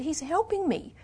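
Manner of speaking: high, defensive plaint; flustered pleading. A narrator's reading. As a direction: plead for those who help you. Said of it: "she stammered".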